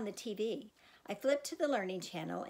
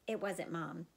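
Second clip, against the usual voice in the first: ordinary delivery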